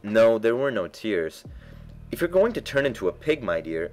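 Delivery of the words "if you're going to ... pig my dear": mildly stern warning, starting high; serious. A narrator's reading. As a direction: warn the baby in earnest. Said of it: "said Alice seriously".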